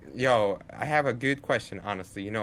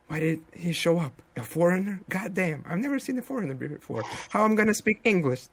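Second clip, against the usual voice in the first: higher-pitched, acted-out startled panic; flustered astonishment; 6 semitones higher, faster than usual. Local reacting to a foreigner showing up, flustered astonishment. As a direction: play a local thrown by a foreigner.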